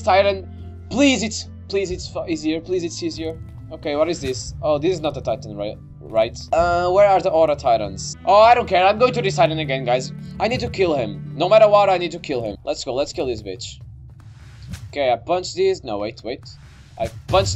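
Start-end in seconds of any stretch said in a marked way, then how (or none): none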